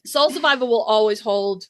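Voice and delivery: ordinary talk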